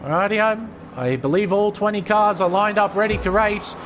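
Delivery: ordinary talk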